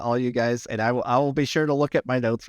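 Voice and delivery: ordinary talk, nothing marked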